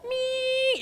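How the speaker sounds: very high, drawn-out squeal; seething steam noise. Acted. Martha fuming in the kitchen, seething squeal. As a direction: squeal like steam escaping a kettle. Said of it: "just seething".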